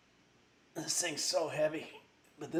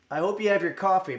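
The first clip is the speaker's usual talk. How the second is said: upbeat and louder, quick; rally the chat, a bit faster; excited; 5 semitones higher, 7 dB louder, faster than usual. Real, not acted. Energetic stream opener.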